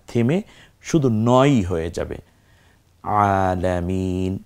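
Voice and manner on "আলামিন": slow, lengthened Quranic reading of আলামিন; ধীরে টেনে পড়া; slow recitation. Shown as a demonstration. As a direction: draw out আলামিন slowly and evenly. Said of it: "একটু পড়ে দেখি".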